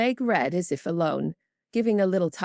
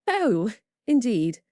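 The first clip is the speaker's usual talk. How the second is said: slow, drawn-out, faintly appalled "Oh, indeed"; lightly voiced snobbish lady's cool dismay; disdainful tone; 6 semitones higher, 4 dB louder, slower than usual. A narrator's reading. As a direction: let the disdain show as if saying how dreadful. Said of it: "for her tone implied it".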